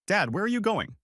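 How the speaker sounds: high, urgent call after him. Acted. Son calling after his father walking to the truck, alarmed. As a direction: call out to stop him leaving. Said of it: "I called".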